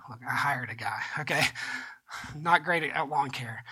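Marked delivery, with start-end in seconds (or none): 0.1-1.4 whispered aside. Real, not acted. Aside defending his lawn care.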